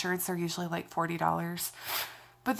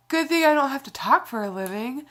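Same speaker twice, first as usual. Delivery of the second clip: sarcastic; 5 semitones higher, 7 dB louder than usual. Real, not acted. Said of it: "That is a joke".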